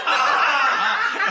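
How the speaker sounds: high, loud startled yelp, half laughing; startled scream. Retold or imitated. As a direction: recreate jumping out of your skin. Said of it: "And I'm like".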